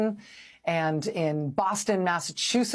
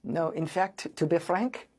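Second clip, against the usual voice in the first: put-on failed French accent; 3 semitones lower, slower than usual. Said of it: "I can't even do a fake French accent".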